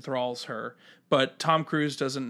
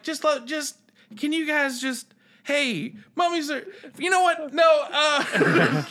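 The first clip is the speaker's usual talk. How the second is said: flustered goofy guy; 13 semitones higher, 4 dB louder, slower than usual. Retold or imitated. Flustered character rambling, breaking off.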